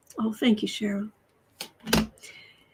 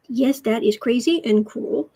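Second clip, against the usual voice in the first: ordinary talk, nothing marked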